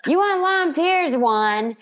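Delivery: high mock Monica retort; imitating Monica. Monica firing back at Ross over Mom's food, high voice. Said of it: "Ross shows up".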